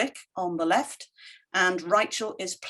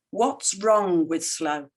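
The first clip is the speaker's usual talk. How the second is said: What's wrong with slow, slowed right down and weighed; slow, pointed question; 2 semitones lower, slower than usual. Real, not acted. Speaker to audience, repeating the question slowly and deliberately. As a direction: let each word land, slowly.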